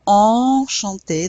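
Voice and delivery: loud, slow "Enchanté" with both en vowels through the nose; loud, slow «Enchanté» with nasal vowels. Shown as a demonstration. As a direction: project "Enchanté" slowly so the nasal en rings out. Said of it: "that E N, en".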